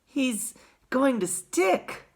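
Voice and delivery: put-on high, slow boy's voice; drawn-out anxious observation; Charlie. A narrator's reading. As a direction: say it slowly, in a child's pitch. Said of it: "said Charlie".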